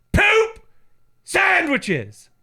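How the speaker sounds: very high, loud shriek of a mom; mom's loud punchline. Retold or imitated. Mom answering her son's daily dinner question, shrieking. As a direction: screech it like a fed-up mom. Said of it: "My mom goes".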